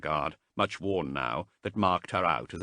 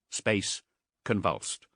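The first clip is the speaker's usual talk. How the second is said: ordinary delivery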